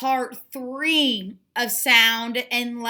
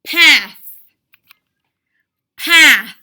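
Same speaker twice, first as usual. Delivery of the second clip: "path" called out much louder, clear and firm; loud word call; 2 semitones higher, 10 dB louder than usual. Shown as a demonstration. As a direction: announce "path" loudly to the class. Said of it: "Now we're gonna try".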